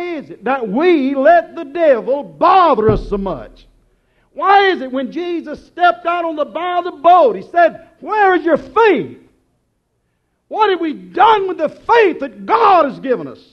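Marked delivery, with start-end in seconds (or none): 4.3-9.2 high, loud preacher's shout; full-force preaching. Real, not acted. Preacher shouting at the church about faith. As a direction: thunder the question at the congregation.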